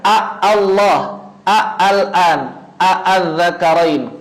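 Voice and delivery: "A Allah, A al-an, A al-zakarain" with two separate A onsets; both hamzahs read. Shown as a demonstration. Teacher trying out reading the hamzah wasal, both hamzahs distinct. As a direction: sound each initial A apart from the next word, slowly. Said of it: "kita baca aja deh hamzah wasalnya".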